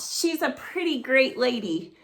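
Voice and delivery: ordinary talk, nothing marked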